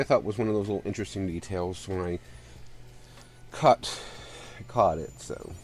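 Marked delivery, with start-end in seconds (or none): 3.5-5.5 winded, short of breath. Real, not acted. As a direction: speak winded and congested. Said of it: "excuse me for being winded".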